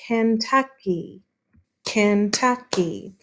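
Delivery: "Kentucky" slowly as Ken-tuck-y; syllable by syllable. Shown as a demonstration. Teacher counting syllables, Kentucky slowly broken apart. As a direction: separate the three beats of "Kentucky". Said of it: "Kentucky has three syllables".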